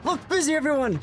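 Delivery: high-pitched, playful urgent call; mock panicked order. Streamer orders viewers to look busy for boss, mock panicked.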